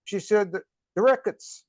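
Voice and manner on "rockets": "rickets" with a short Israeli i vowel; Israeli accent. Retold or imitated. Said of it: "with the Israeli accent".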